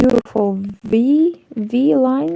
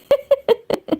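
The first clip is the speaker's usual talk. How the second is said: high laugh. Real, not acted. Laugh at joke.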